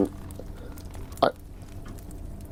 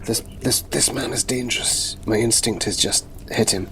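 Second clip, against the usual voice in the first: hushed, softer warning; whisper; 4 dB quieter than usual. Acted. Holding partner's shoulder, warns him in a whisper.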